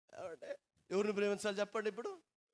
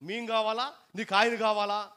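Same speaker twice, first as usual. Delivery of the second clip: 1 demanding ultimatum; 4 semitones higher, 6 dB louder than usual. Retold or imitated. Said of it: "అయితే మాకొద్దు".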